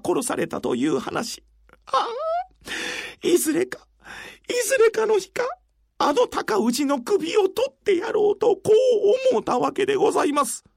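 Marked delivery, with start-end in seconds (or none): 1.9-10.6 crying. Acted. Weeping man tells tragic tale.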